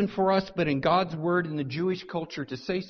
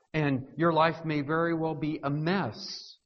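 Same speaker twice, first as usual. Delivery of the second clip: normal talking voice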